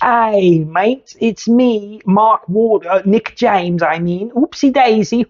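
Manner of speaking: high, chirpy fake British bloke; jokey put-on British accent; mock British accent. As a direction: ham up a cheery English lad. Mock Brit impersonating player.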